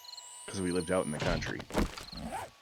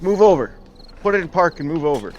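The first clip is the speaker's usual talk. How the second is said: loud barked command, much higher; urgent order; 10 semitones higher, 12 dB louder than usual. Retold or imitated. Telling sister stuck on ramp to move over, loud shout. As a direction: yell it at her through the window.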